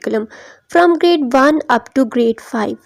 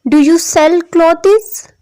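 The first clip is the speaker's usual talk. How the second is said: slow question climbing high on "clothes"; rising intonation; 4 semitones higher, much slower than usual. Shown as a demonstration. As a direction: lift your pitch through to "clothes". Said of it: "question वाले sentences में भी जो है वो rising होती है". ("clothes" पर ऊपर चढ़ती आवाज़)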